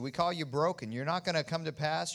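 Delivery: loud commanding rebuke of the storm; laying a curse. Acted. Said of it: "And we curse you".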